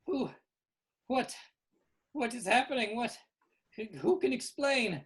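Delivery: high, loud, flustered questions; bewildered Winky Wally. Acted. Winky Wally demands an explanation, high and flustered. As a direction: sputter in high-pitched confusion.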